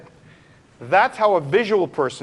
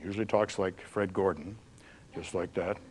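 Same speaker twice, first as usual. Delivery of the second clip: deep kinesthetic voice; 9 semitones lower, 9 dB quieter than usual. Shown as a demonstration. Teacher demoing how a kinesthetic person talks, deep and soft. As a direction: sink the voice down low and quiet. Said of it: "usually has a deeper voice".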